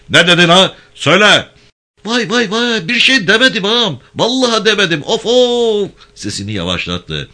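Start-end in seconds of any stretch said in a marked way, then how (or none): 0.1-1.5 much higher, louder staged bellow; mock interrogation shout. Gendarme faking a harsh interrogation for listeners outside. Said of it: "sesini yükseltti". 2.0-5.9 much higher, louder mock cries of pain; fake wailing. Prisoner faking cries so the guards think he's beaten. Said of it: "Bağır hadi".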